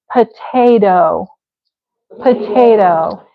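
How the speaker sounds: "potato" as puh-TAY-doh; D sound. Shown as a demonstration. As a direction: soften the second T of potato to a D. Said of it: "I usually put the D".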